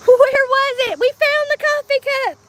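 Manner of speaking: thrilled to have found it; excited discovery. Real, not acted. Finding the cup excitedly.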